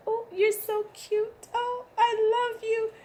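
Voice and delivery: mother photographing her daughter, high cooing; cooing to child. Retold or imitated. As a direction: coo over the little one. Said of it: "I go".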